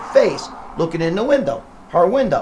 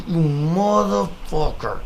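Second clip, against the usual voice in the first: mock snarl; much slower than usual. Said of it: "I was like".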